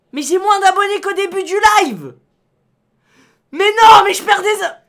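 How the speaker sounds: exasperated yelling. Real, not acted. Streamer begging chat to resubscribe, loud and exasperated. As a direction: berate chat loudly, at wits' end.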